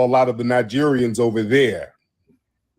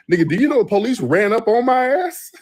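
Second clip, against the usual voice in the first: high, astonished question; high-pitched shocked storytelling; incredulous; 11 semitones higher than usual. Real, not acted. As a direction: voice jumps up in disbelief. Telling police surprise.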